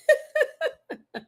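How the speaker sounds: a high giggling laugh; high burst of laughter. Real, not acted. Laughing at viewer.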